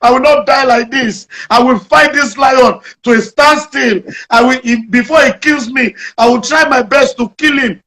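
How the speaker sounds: a man hyping himself against a lion; determined vow. Retold or imitated. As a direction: psych yourself up to fight the lion. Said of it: "He now made up his mind".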